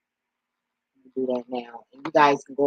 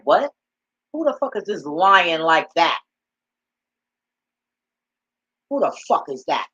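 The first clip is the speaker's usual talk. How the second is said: a touch of incredulous outrage, a bit louder; indignant disbelief; 2 semitones higher, 5 dB louder than usual. Retold or imitated. Her inner spirit confronting a lying man, incredulous. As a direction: demand to know who is lying. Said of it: "the spirit in me turned around like".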